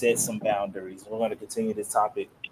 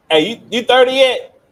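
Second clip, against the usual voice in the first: teasing; 14 semitones higher, 16 dB louder than usual. Real, not acted. Teasing shout at friend.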